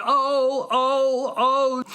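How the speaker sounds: high wailing cries of oh; theatrical high-pitched yelps; cried-out ohs. Acted. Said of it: "Conrad cries out".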